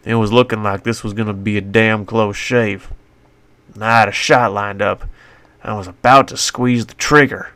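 usual speaking voice

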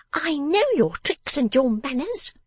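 high, drawn-out scolding; cried accusation. A narrator's reading. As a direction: accuse him in a high, knowing voice. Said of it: "cried Miss Wren".

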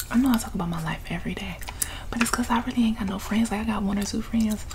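normal talking voice

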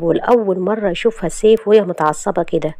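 ordinary delivery, nothing marked

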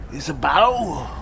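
normal talking voice

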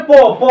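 প made with the lips; labial প. Shown as a demonstration. Teacher shows class the lip sound, labial প. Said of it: "এটা মানে ঠোঁট".

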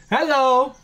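normal talking voice

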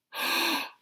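loud high theatrical gasp; shocked gasp. A narrator's reading. Neighbor sees the child's tongue out, shocked gasp. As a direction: gasp sharply, scandalized. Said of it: "Missus Whistler gasped".

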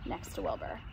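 plain reading voice

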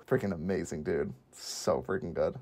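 low, soft, awed aside; hushed awe. Real, not acted. Host savours a favourite film scene, hushed awe. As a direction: drop low and quiet, marvelling.

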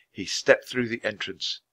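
ordinary delivery, nothing marked

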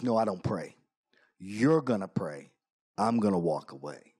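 very low gruff biker; low, curt, gruff refusal. Retold or imitated. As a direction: imitate a big biker laying down orders, deep. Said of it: "in his gruff voice".

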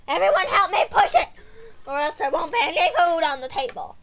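fast, loud bossy yell; urgent shouting mom; shouted threat. Acted. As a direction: order everyone to push, rapidly and loudly. Mother threatens children into pushing.

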